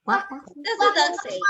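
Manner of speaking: quick, high prompt. Shown as a demonstration.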